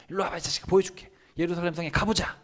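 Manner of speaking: a touch of acted anger; irritated command. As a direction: drag him along, irritated. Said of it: "하나님 기분 안 좋으세요".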